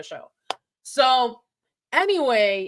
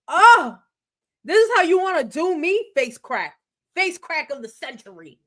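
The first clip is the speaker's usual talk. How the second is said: sassy drag queen; 4 semitones higher, 5 dB louder than usual. Retold or imitated. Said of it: "Bob is like".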